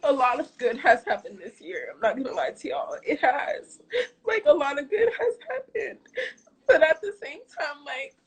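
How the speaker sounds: fighting tears through the words; tearful. Real, not acted. Streamer tells viewers good things happened, still tearful. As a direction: still crying, voice high and unsteady.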